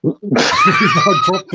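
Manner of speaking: high squeal of laughter through the words; cracking up at the statue; laughing. Real, not acted. Laughing at dropped figure.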